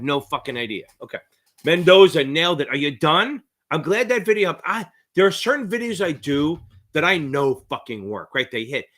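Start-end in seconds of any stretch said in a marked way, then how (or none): none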